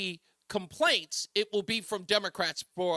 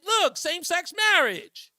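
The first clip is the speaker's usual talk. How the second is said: high, loud mocking voice; 10 semitones higher, 5 dB louder than usual.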